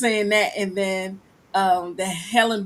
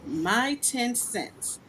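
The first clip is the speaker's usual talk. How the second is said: usual speaking voice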